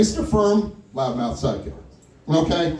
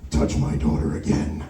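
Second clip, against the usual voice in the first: menacing threat; 10 semitones lower than usual. Retold or imitated. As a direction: growl it close into his ear. Pull him in real close.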